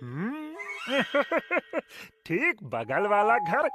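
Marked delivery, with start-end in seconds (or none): 0.6-1.8 quiet scornful chuckle; sly chuckle. Acted. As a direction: snicker at the stranger's expense. Rude host mocks the traveller.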